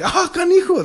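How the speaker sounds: very high mock reaction; amused Spanish exclamation. Showing how we react to a joke, high exclamation. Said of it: "Like, to us, we're like".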